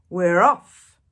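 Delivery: loud cheering shout; rowdy pirate cheer; crew shout. Acted. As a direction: yell it with the whole crew. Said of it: "we all shouted".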